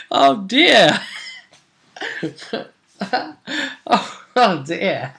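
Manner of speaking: words squeezed out between high laughs; real giggling fit; helpless laughter. Real, not acted. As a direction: try to speak while cracking up. Still laughing at the death.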